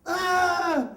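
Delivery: loud high yelp, hammed-up; faked pain yell. Boy with book in pants fakes a yell for the nun, loud. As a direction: fake a howl though nothing hurts. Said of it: "I was like".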